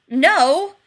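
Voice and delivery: loud, high, emphatic refusal; irritated refusal. A narrator's reading. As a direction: shut it down with a sharp shout.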